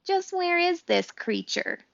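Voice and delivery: high, slow fox question. A narrator's reading. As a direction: draw out the question like a wary fox. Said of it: "said Fink the fox".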